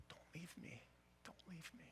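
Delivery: soft whispered plea; wife whispering to her husband, begging him to stay; weeping whisper. Retold or imitated. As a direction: whisper it, begging through tears. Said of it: "she's weeping at this point".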